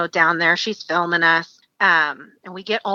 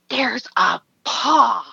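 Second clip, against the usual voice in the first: slow, drawn-out mock alarm; alarmed realization; 4 semitones higher, much slower than usual. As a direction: stretch each word, imitating a horrified discovery. Imitates her sudden alarmed discovery.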